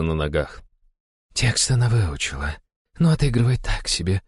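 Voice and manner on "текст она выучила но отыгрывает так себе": lightly voiced Naga. A narrator's reading. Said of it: "поделилась со мной мнением Нага".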